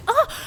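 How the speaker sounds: sharp high pained cry. Acted. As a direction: yelp as pain stabs your head. Sudden painful headache.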